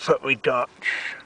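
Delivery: talking round a torch; speaking with something in mouth; mouth full. Real, not acted. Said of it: "the talk's in my mouth then".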